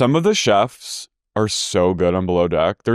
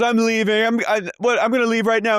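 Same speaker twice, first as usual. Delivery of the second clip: much higher, flustered threat to leave; mock Lisa; 12 semitones higher than usual. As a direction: stammer out the exit like Lisa. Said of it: "She goes".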